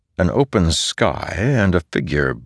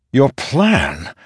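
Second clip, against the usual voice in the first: high, challenging question; pushing; 8 semitones higher than usual. A narrator's reading. Dalinar challenging the storm god's denial, pushing. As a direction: push back with a pointed question. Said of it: "Dalinar pushed".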